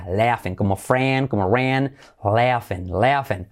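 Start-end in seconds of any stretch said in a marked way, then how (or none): 0.1-0.5 "laughing" as "LEA-fen", GH as F; correct LEAF-en sound. Shown as a demonstration. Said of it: "como una E". 2.2-3.5 "laughing" as "LEA-fen", GH as F; correct LEAF-en sound. Shown as a demonstration. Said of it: "como una E".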